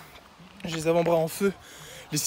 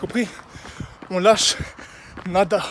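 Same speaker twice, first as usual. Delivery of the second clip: loud pep shout; 3 semitones higher, 4 dB louder than usual. Real, not acted. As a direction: pump yourself up. Self-motivating shout while running.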